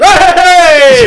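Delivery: very high, loud greeting shout; Richie's big Hey. Retold or imitated. Mate walking into the room with a huge high shouted Hey. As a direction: bellow Hey as you burst into the room. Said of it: "he's like, the biggest ones".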